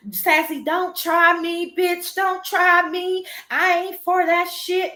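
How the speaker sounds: high, drawn-out mocking tirade; mock angry. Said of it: "she gets mad at me and she starts".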